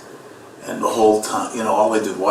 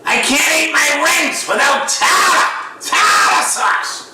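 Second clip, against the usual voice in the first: put-on shrieking Boston-accented seagull, high and drawn out; slower than usual. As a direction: squawk it like a gull with a Boston accent. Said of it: "they talk with Boston accents".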